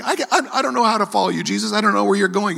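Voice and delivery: high, pleading believer voice; anxious believer. Retold or imitated. Preacher voicing a lost believer talking to Jesus. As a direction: play the unsure follower pleading. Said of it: "It's like".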